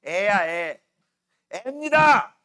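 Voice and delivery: teasing. Acted.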